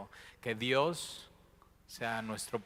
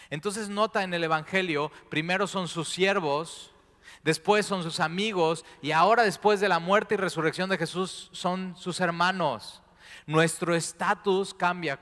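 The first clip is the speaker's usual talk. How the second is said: loud, high, impassioned preaching voice; preaching at full force; 6 semitones higher, 7 dB louder than usual. Acted. Preacher voices Mary's desperation at Jesus' feet, loud and fervent. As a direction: lift the congregation with fervent urgency. Preacher climbs on servants, friends, brothers.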